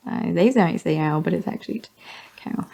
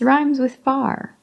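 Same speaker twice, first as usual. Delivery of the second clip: usual speaking voice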